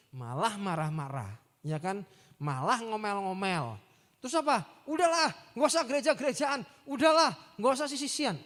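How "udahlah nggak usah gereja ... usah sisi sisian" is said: mock huffy dismissal, a bit louder; sulky retort. Pastor imitates an offended member quitting church, huffy. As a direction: toss it off like a sulking quitter.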